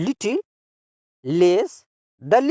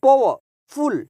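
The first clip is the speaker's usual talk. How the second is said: Powerful slowly, higher, pause before फुल; two separate beats in powerful; split into parts; 4 semitones higher, slower than usual. Shown as a demonstration. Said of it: "ये टू syllable adjectives कहलाते हैं".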